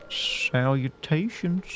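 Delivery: very slow "Salutations" with whistled s at both ends; tooth whistle. Shown as a demonstration. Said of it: "strengthen this ability".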